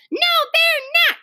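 high tearful protest; crying out. A narrator's reading. Little guest contradicts hostess, crying out. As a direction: cry it out in a high child voice. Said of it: "cried Susan".

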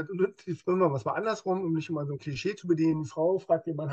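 normal talking voice

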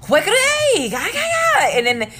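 high, loud, drawn-out slow "What"; mock arguey Korean speaker. Explaining how Korean sounds to outsiders: loud, slow arguey exclamation. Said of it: "it sounds arguey".

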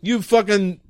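angry police chief. Acted. Chief berating detective caught in traffic, angry. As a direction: chew out your officers as the chief.

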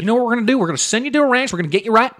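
mock TV counselor laying down the plan; Doctor Phil. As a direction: announce the cure with big confidence. Mimicking host announcing the ranch fix.

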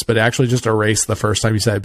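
normal talking voice

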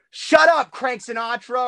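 high, loud mock yell at a roommate; retold yell. Suite mate yelling at him while he records, mock yell. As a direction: bark it like an annoyed suite mate. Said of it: "there would be times where he'd yell".

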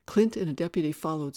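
ordinary delivery, nothing marked

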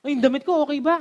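high, fast, flustered rambling; hammed-up mock panic, stammering; panicking. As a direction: act out a hostess in a frantic scramble before the guest. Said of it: "nagpapanik na siya".

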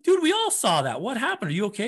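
retold alarm. Coach questioning runner, alarmed.

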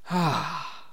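soft, easy sigh of relief; relaxed sigh. Shown as a demonstration. Host showing listeners his chill mode, with a soft sigh. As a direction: breathe out like nothing matters. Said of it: "the way I can just be like".

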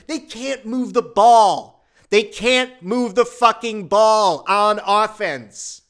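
loud, slow, exasperated rant; fed-up fan yelling at the team; frustrated outburst. Real, not acted. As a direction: spit out each word in disgust. Fuming over the offense.

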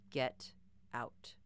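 low, cold whisper; cold menacing whisper. A narrator's reading. Bear threatens girl, cold low whisper. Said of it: "it sounded cold, almost menacing".

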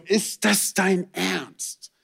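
slow, drawn-out exasperated question; mock despair over torn rubbish bags; exasperated disbelief. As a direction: let each word sag in disbelief at the mess. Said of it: "und du bist so".

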